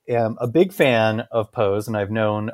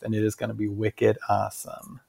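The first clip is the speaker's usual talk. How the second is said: softer, lightly put-on Boston accent; 9 dB quieter than usual. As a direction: finish with a quiet hint of Boston. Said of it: "more of that Boston accent".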